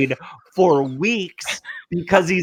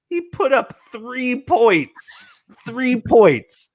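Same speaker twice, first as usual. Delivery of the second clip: higher, slow disbelief at three points; incredulous mockery; 7 semitones higher, slower than usual. Real, not acted. Taunting Cody about his starter's three points, higher mocking disbelief. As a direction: rub the three points in with raised pitch.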